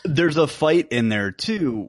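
ordinary delivery

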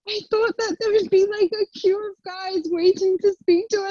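high, breaking, imitating her crying self; tearful whine. Telling friends why she cried, high tearful whine. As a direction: whimper it out like a let-down girl. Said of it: "I just start crying".

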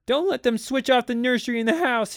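put-on high, loud child's voice; Peter's pleading. A narrator's reading. Boy outside the door begging, pleading. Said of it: "He heard Peter's voice".

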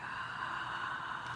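whispered, drawn-in reaction; whispered dismay. Retold or imitated. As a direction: let it out as a tiny whisper. Retold dismay at accent mark.

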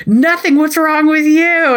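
high-pitched mock indignation; hammed-up, high defensive outburst; defensive retort. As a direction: fire it back like a touchy spouse. Acted defensive retort in spouses' quarrel.

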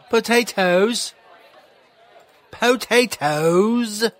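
high, drawn-out mock Irish accent; put-on Irish accent. Shown as a demonstration. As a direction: stretch the word "Potatoes" in a high fake Irish accent. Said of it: "My accent's pretty good".

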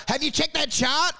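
high-pitched pushy sales call; mild salesman yell. Acted. Snakeskin salesman phoning a client, mildly yelling. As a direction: lightly play a yelling salesman. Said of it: "They just want a snakeskin salesman to yell".

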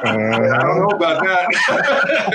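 slow words through real laughter; laughing. Real, not acted. Laughing at his own rookie story, slow and amused. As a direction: laugh it out slowly.

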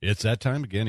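normal talking voice

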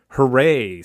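loud but hollow hooray; joyless, emptied-out celebration; empty cheer. A narrator's reading. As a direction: cheer with nothing behind it. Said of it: "said Whaling emptily".

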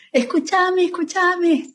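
put-on high whiny child pleading; high-pitched mock child begging to be heard; child's tantrum. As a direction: whine it like a small kid in a tantrum. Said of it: "un niño haciendo una rabieta".

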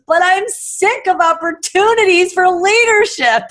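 mock the kid shouting his protest; retold yell. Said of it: "he yells out from the driveway".

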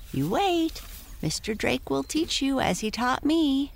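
high reassuring pony voice; talking pony. Acted. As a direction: encourage like a kind pony. Prancer voice.

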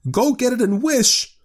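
high, loud frantic order; quivering excitement. A narrator's reading. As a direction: urge your husband with feverish excitement. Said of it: "quivering with excitement".

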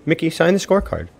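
ordinary delivery

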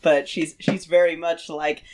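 ordinary talk, nothing marked

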